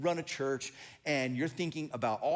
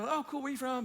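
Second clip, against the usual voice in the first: chirpy small talk; 10 semitones higher, faster than usual. Retold or imitated. Acted small talk between pastors.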